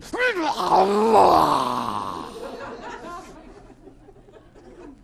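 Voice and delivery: long, loud, high roaring scream; monster roar. Retold or imitated. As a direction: explode into an acted monstrous howl. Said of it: "this monster came out of me".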